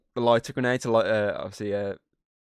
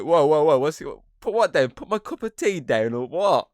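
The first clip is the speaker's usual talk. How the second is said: higher, louder baffled protest; mock confused bloke; 6 semitones higher, 6 dB louder than usual. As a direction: act startled and puzzled. Acted confused reply to shouting.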